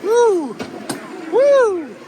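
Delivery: high, loud woo-hoo whoops; excited whoops. Real, not acted. Rider enjoying the water ride, high excited whooping.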